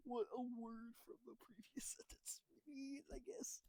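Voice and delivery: hushed unvoiced whisper. Real, not acted. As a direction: breathe the words out, no voice. Whispered aside after sneeze.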